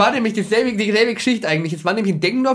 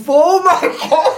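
laughing; 10 semitones higher, 3 dB louder, much slower than usual. Real, not acted. Shocked realisation mid-story.

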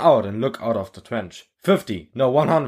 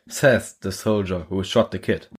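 usual speaking voice

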